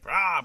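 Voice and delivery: short loud mock roar; brief playful bellow of a big man; burly-man roar. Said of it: "big burly man, so you'd expect him".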